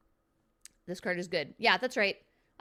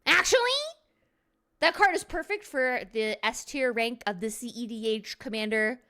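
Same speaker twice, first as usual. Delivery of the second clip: nerdy know-it-all; 2 semitones higher than usual. Retold or imitated. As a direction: play the smug rules lawyer correcting a friend. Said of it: "And someone's like".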